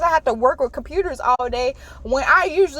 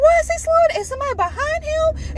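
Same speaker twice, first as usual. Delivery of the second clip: puzzled disbelief. Retold or imitated. Puzzled at slowing driver.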